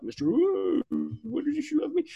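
high mocking whiny voice; mocking whine. Mocking the friend-zoned guy, high whiny mock.

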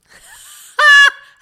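loud, high burst of laughter; real high-pitched laugh. Real, not acted. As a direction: let out a big sudden cackle. Reacting to co-host's possible diagnosis.

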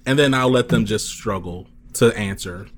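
ordinary delivery

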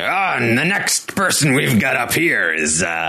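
lightly voiced pirate-ish announcer; a hint of a booming ring announcer; wrestler voice. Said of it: "the wrestler voice".

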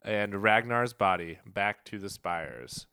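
ordinary talk

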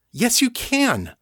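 high, slow, emphatic insistence; insisting. A narrator's reading. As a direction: dig in and insist. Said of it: "Kate insisted".

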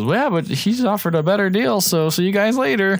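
high, airy brush-off; breezy dismissal. Retold or imitated. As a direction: wave them off cheerfully. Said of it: "Tyrion just goes".

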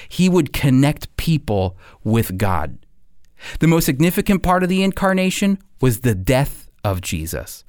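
usual speaking voice

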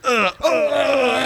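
high strained mock grunting; mock grunts. Doctor reaching in, high mock grunts. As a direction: grunt it out like he's digging. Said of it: "just reaches into my ass and just goes".

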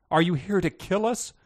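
ordinary delivery

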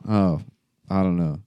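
ordinary talk, nothing marked

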